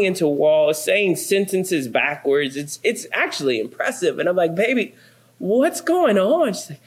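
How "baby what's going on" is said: high, slow, tender asking; gentle concern. Retold or imitated. Husband asks shaking hungry wife what's wrong, tender and slow.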